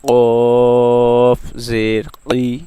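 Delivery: teacher demonstrating the heavy qaf; slow spelled recitation. Shown as a demonstration. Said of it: "आइए practice करते हैं".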